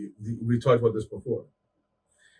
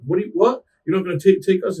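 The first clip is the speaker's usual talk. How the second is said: higher and louder shocked protest; indignant disbelief; 8 semitones higher, 6 dB louder than usual. Retold or imitated. Husband protesting the driver's refusal, higher and louder disbelief. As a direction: act outraged that he won't drive them. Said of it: "her fucking husband or whatever was like".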